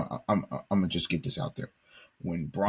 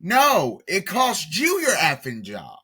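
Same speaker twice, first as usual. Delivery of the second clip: much higher, louder emphatic correction; emphatic outburst; 8 semitones higher, 4 dB louder than usual. Real, not acted. Commentator insisting the firing was deserved, loud and emphatic. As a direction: hammer it home, fed up.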